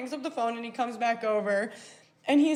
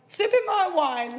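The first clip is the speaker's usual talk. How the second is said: normal talking voice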